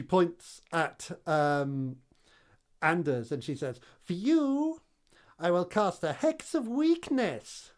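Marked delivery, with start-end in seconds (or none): 4.0-7.7 witch voice. Acted.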